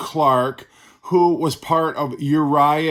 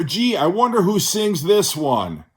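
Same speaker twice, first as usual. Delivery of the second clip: higher, sing-song feigned curiosity; sarcastic mock wonder; 7 semitones higher, 4 dB louder than usual. Album reviewer feigns ignorance of the singer, sarcastic. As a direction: play innocent, as if you truly had no idea.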